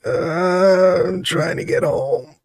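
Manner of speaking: slow, soft imitated drunk; drunk man. Drunk man telling an officer where he's headed, slow mock slur. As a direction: drag the words out like a drunk. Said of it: "really drunk and saying it really slowly".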